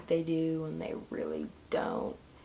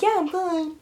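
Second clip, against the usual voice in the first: breezy brush-off; 11 semitones higher, 6 dB louder than usual. Retold or imitated. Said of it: "I'm like".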